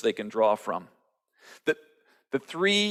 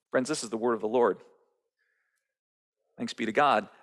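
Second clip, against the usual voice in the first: usual speaking voice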